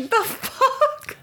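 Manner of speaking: high, loud incredulous yelp; shocked. Retold or imitated. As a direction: blurt it out in disbelief, way up high. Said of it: "You were like".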